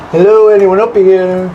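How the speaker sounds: loud, slow call into the dark. Real, not acted. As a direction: call out to anyone hiding up there. Calling out into empty attic.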